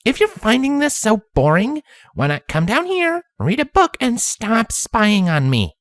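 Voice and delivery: put-on high girlish pony voice; bright little filly; young filly voice. Twilight calls up.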